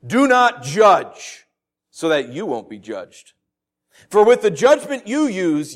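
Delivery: scripture reading. Acted. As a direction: read the verses out with weight, building to the end. Reads scripture aloud.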